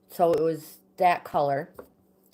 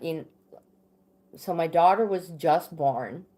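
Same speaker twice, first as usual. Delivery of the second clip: ordinary talk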